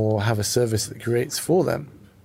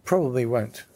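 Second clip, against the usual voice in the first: ordinary talk, nothing marked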